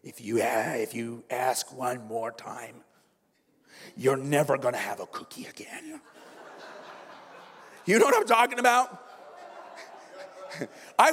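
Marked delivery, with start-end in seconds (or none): 0.0-6.0 low, slowing mock ultimatum; parent's threat. Parent threatening the kid with no more cookies, menacing. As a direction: warn it like a parent at breaking point. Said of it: "at the breaking point".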